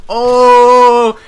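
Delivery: long, high, loud "ohhh"; loud stunned "ohh". Retold or imitated. Classmates reacting to the comeback, loud drawn-out "ohhh". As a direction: whoop one held note like a crowd at a burn.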